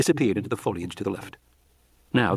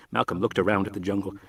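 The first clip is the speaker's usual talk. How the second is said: ordinary delivery, nothing marked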